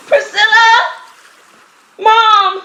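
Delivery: shrill desperate shout across water; frantic calling out. Acted. Daughter screaming for her mother in the sea, desperate shout. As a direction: call out for your loved one, panicked.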